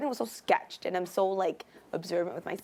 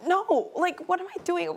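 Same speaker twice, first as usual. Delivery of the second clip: freaked out; 9 semitones higher than usual. Retold or imitated. Handed snakes, freaking out.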